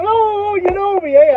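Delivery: imitated delighted surprise, high-pitched; high, eager retold greeting; happy. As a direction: play him thrilled at being recognised. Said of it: "he looked at me all happy".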